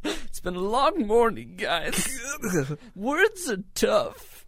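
put-on high, slow old woman voice. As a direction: quaver it like an old lady. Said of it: "That voice you were doing is very old womany".

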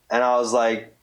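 normal talking voice

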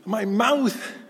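high, slow, mock-tearful little boy; imitated crying child. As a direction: wail it slowly, imitating an upset child. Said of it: "he's crying and he says".

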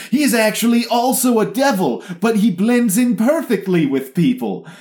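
high, loud mocking reveal voice; mock dramatic reveal. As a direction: announce it like a big twist.

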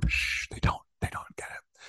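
conspiratorial whisper, breath only. Pretend. Telling the host in a mock secret whisper. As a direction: hush it like a forbidden secret.

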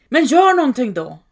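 a frantic roar for help; roaring demand. A narrator's reading. Mother roars at father to do something, loud and high. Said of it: "vrålade mor åt far".

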